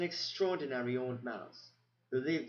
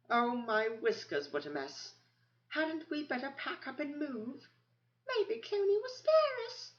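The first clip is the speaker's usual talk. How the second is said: much higher, squeaking in alarm; panicky mouse; 14 semitones higher than usual. Acted. Frightened mice crying out about fleeing, high and panicky.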